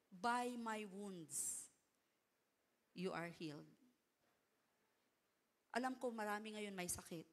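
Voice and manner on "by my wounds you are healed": slow, tender promise. Acted. Quoting Jesus' healing promise.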